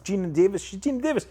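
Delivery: high-pitched, awed name-drop; starstruck emphasis. Real, not acted. Director telling host about the Oscar winner, starstruck.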